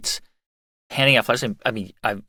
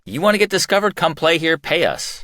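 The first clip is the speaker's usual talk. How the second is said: higher, mocking sales pitch; mock club owner; 6 semitones higher than usual. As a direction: sell it like a smug club owner. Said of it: "some of these clubs were like".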